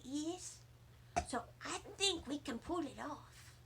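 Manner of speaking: put-on a little girl hushing her voice to conspire; whispery voice. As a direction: share a secret plan quietly. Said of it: "I made my voice very whispery".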